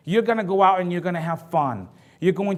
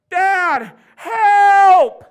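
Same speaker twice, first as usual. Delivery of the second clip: high, loud, desperate call for help; daughter crying for help; 13 semitones higher, 9 dB louder than usual. Retold or imitated. Daughter in trouble calls her dad: loud, high panicked cry. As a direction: cry out from across the water, panicked.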